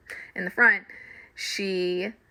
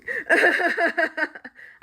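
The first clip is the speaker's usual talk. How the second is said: big laugh; 11 semitones higher, 4 dB louder than usual. Real, not acted. Laughing at own story.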